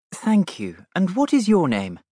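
put-on a kind princess stooping to a child; cartoon princess. Princess bends to a little girl.

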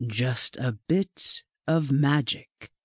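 slow, low and mysterious; paused for effect. A narrator's reading. Librarian hinting at magic to the class, slow and low. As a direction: let each word hang, dropped low. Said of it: "he paused for effect".

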